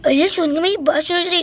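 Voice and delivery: usual speaking voice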